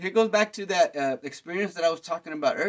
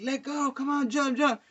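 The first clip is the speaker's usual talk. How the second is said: high-pitched imitated crowd chant; mock crowd calling up to a climber; crowd egging on; 9 semitones higher than usual. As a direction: urge him to jump. Imitates crowd urging the kid.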